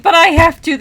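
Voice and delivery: high, loud mock-desperate protest; defiant yelling. Reader justifying her yelling to the dogs, defiant shout. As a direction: cry out in hammed-up desperation. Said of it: "You don't need to yell".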